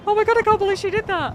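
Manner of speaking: high, loud, rushed gasp of outrage; mock shocked disbelief. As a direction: imitate a celebrity aghast at being slapped. Mimics Lohan's reaction to being slapped.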